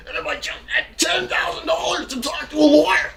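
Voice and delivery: very high, loud raging scream; furious screaming. Real, not acted. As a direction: scream it, voice cracking with rage. Screaming accusations, near tears.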